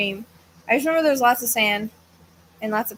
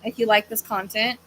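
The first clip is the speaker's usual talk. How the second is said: ordinary delivery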